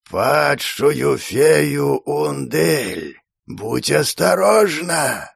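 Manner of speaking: slow, whistling, slightly creaky tree voice; like wind lost in a dense forest. A narrator's reading. The tree speaks.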